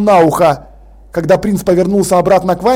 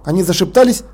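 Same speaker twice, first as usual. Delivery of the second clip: ordinary delivery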